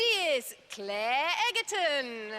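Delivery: slow, drawn-out contestant intro; booming prize announcer. Acted. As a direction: build up the name.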